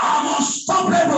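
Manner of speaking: loud, high proclamation; shouted declaration. Shown as a demonstration. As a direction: proclaim it at full voice. Preacher leads shouted declaration.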